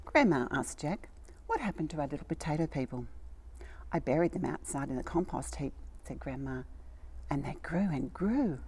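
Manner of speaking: put-on gentle read-aloud storytelling for children; storybook reading. A narrator's reading. Reading a story to little children, storybook voice. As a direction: tell it like a bedtime picture book. Reads a picture book aloud.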